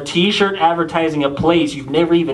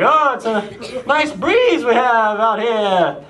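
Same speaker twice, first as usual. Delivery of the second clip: high, slow small talk; cheesy pickup line, trailing into a squeaky yeah; corny high-pitched flirt; awkward icebreaker; 8 semitones higher, much slower than usual. Retold or imitated.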